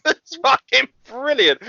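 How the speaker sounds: ordinary talk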